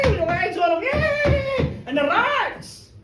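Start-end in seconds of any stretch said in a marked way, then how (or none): 0.9-1.8 high, loud yay; boy whooping as he runs downhill; gleeful cheer. Acted. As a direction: shriek with childish joy.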